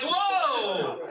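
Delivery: high, stretched exclamation of shock; astonished whoa. Acted. Dad hears son's devil theory, astonished. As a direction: burst out as if floored.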